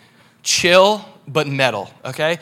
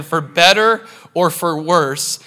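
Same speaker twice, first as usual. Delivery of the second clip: BETTER and WORSE stretched out slowly; slow, weighty stress on better and worse; emphatic repeat; slower than usual. Real, not acted. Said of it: "Let me say that again".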